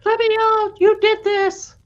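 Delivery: high, loud mock scream of accusation; imitated screaming.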